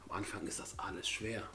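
soft confiding whisper. Real, not acted. Host tells viewers about the caterpillar stage, whispering. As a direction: let them in on a secret, whispered.

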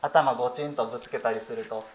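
ordinary delivery

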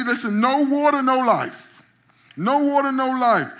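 loud, high repeated punchline; emphatic shout. Real, not acted. Preacher shouting the refrain to his flock, loud and high. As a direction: hammer the point home. Preacher drives home the point.

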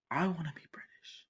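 soft, voiceless whisper. Real, not acted. Confessing the wish to be British, whispered. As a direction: confide it in a whisper.

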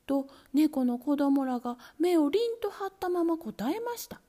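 plain reading voice

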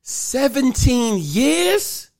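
slow, loud, high disbelief. Real, not acted. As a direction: stretch it out in shock. Disbelief at seventeen years.

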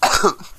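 dry cough; a short hacking cough. Real, not acted. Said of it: "my lungs are collapsed".

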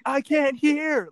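high, loud, drawn-out self-mockery; mock complaint. Streamer shows viewers how clueless they look, mock loud wail. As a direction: wail it high and slow, hammed up. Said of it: "And I'm just like".

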